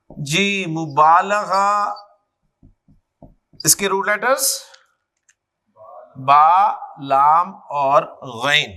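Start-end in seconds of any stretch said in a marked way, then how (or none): 0.1-1.7 मुबालगा stretched very slowly, syllable by syllable; very slow drill. Shown as a demonstration. Teacher to students, spelling out मुबालगा very slowly. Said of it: "teacher stretches the word slowly". 6.2-8.7 root letters named slowly and lower; slow spelling out. Shown as a demonstration. Teacher to class, slowly naming root letters. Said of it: "teacher spells out root letters".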